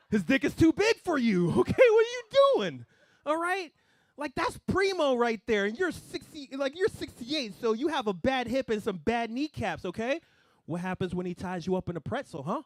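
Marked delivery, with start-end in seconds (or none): 0.1-10.2 high, loud, laughing rant at his mom; shrieking rant. Retold or imitated. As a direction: scold her in a shrill laughing outburst. Lecturing mom, rising to shrieking.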